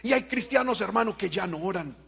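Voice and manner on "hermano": ordinary talk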